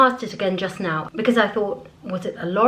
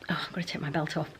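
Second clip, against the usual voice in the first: fast whispered aside, much softer; quick whisper aside; 3 semitones lower, 8 dB quieter, much faster than usual. Real, not acted. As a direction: drop to a rushed whisper to yourself. Aside while changing on camera.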